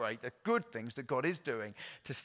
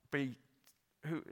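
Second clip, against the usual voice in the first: ordinary talk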